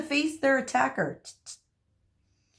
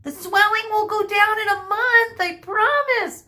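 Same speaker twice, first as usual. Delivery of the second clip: high, loud, urgent call; screamed; 10 semitones higher, 5 dB louder than usual. A narrator's reading. Medusa calls back as she runs out, screamed. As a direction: shout a desperate promise in a high voice. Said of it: "Medusa screamed".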